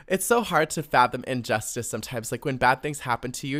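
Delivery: ordinary talk, nothing marked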